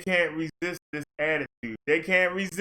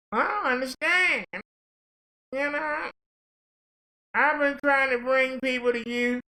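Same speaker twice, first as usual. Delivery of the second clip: much higher, whiny protesting voice; pleading rejected preacher; 7 semitones higher than usual. Acted. Rejected preacher protesting to Jesus, high and pleading. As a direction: protest your innocence, high and aggrieved.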